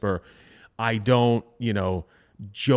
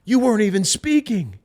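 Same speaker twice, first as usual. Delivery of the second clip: incredulous; 7 semitones higher, 4 dB louder than usual. Retold or imitated. Coworkers questioning the quiet rep.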